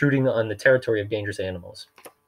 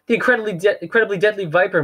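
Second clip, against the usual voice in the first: high, louder stammered repeat; flustered repeating; 10 semitones higher, 4 dB louder than usual. Acted. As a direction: stumble over the name in panic. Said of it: "Mister Poe repeated".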